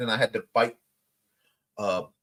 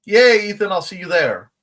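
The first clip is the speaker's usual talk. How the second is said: excited; 5 semitones higher, 9 dB louder than usual. Real, not acted. Cheering to Ethan.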